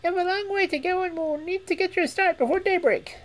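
very high squeaky rat voice; eager, relieved little rodent. Acted. Rat speaks.

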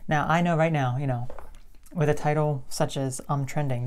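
ordinary talk, nothing marked

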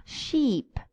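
Sheep with a long, drawn ee. Shown as a demonstration. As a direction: hold the ee in Sheep. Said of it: "Let's see the difference".

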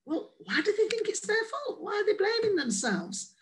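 lightly mocking puzzled outsider; puzzled foreigner. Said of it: "others may understand".

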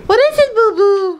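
very high, loud cooing at a puppy. Real, not acted. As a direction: coo at the dog. Baby talk to the dog.

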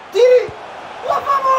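high, loud urgent cry; shout. Real, not acted. Gamer urging a shot on goal, high loud yell.

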